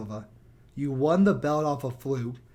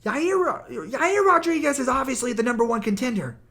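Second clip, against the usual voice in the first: high, loud, mocking Chael voice; mock Chael Sonnen; 10 semitones higher, 5 dB louder than usual. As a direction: play him as a clueless hype man. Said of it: "He's like".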